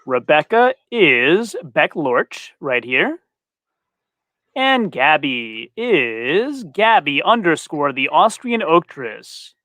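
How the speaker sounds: ordinary talk, nothing marked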